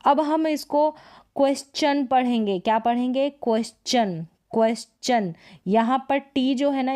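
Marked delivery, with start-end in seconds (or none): 1.4-2.1 क्वेश्चन with a clear "ch" after the S; T read as CH. Shown as a demonstration. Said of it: "इसको च पढ़ा जा रहा है". 3.3-5.3 क्वेश्चन repeated with a clear "ch"; T read as CH. Shown as a demonstration. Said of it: "इसको च पढ़ा जा रहा है".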